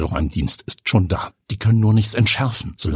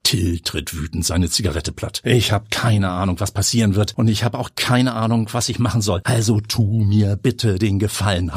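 ordinary delivery

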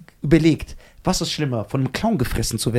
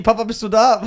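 put-on higher, louder anxious child call; scared child calling out to dad; 7 semitones higher, 6 dB louder than usual. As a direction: call through the door, worried. Child calling to father outside.

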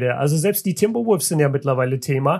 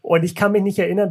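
ordinary delivery

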